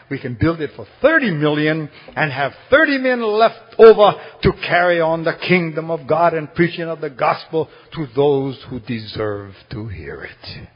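ordinary delivery

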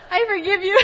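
high, loud laughing repeat. Real, not acted. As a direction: say it through laughter.

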